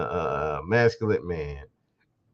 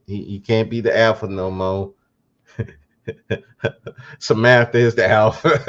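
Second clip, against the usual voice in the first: ordinary talk, nothing marked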